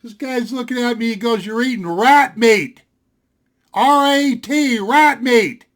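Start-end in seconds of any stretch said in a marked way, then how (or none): 1.5-5.6 louder, slow spelling of R A T; a buddy spelling out rat meat to his friend, loud and slow; spelled-out reveal. Retold or imitated. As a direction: hammer the word rat home.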